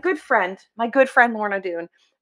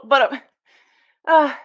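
normal talking voice

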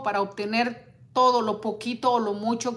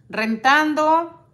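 "Rentando" drawn out slowly, higher and louder; indignant emphasis; 6 semitones higher, 6 dB louder, much slower than usual. Real, not acted. Repeating "Rentando" to her live viewers, high, loud and slow. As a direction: stretch "Rentando" out, outraged at the injustice.

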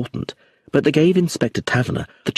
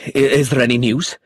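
higher, anxious question with a stumble; agitated haste; 4 semitones higher, 4 dB louder than usual. A narrator's reading. Roger hurries back to ask the inspector, agitated. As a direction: stammer slightly, eager for an answer. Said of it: "Roger Leonides came hurrying back".

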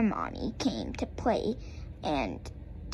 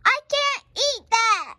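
whiny cry; 10 semitones higher, 5 dB louder than usual. A narrator's reading. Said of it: "I cried and cried and said".

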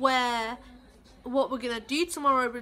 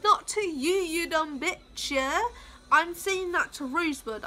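ordinary talk, nothing marked

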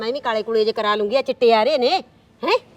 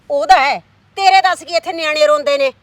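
scolding; 3 semitones higher, 9 dB louder than usual. Acted. Aunt scolding the nephew.

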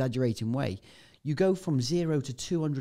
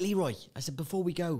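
shouting over wind; 7 semitones higher than usual. Retold or imitated. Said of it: "'cause obviously you gotta shout, it's so loud".

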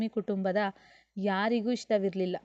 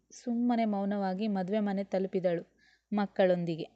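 ordinary delivery

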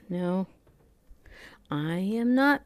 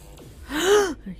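gasp; 10 semitones higher, 4 dB louder than usual. Real, not acted. Sudden scare in game.

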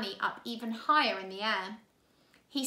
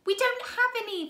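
put-on higher, louder girl's cry; 11 semitones higher, 5 dB louder than usual. A narrator's reading. Violet protesting to Count Olaf, raised voice. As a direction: protest it anxiously. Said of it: "Violet cried".

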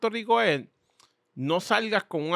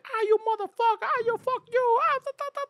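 much higher pitched imitation of two men yelling at each other; mock yelling fight. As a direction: ham up a street fight in a high screechy mock yell. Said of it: "están estos dos negros gritándose". (imitación exagerada y aguda de una pelea a gritos)

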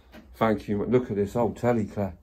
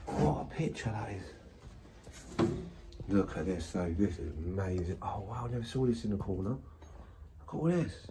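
soft, hushed voice full of wonder; hushed awe; 11 dB quieter, slower than usual. Real, not acted. Exploring an abandoned mansion, hushed and awed.

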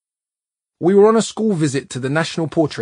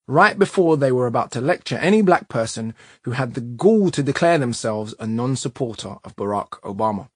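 usual speaking voice

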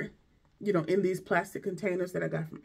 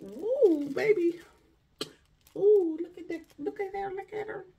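thrilled squeal; 13 semitones higher than usual. Real, not acted. Reveal of second bag.

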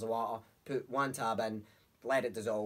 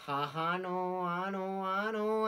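knowing uncle; 6 semitones higher, much slower than usual. Retold or imitated.

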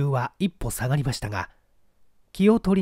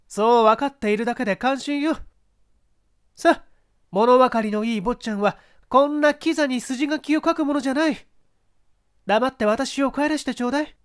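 high, spirited actress voice; proud woman facing her ex; strong-willed mezzo-soprano; 10 semitones higher, 3 dB louder than usual. Acted. Actress confronting her ex-husband, strong-willed and high.

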